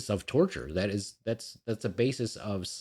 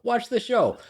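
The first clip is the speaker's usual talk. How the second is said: much higher, louder insistent urging; "Watch the show" jumps up high and loud; excited insistence; 6 dB louder, slower than usual. Real, not acted. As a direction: push them to go watch it.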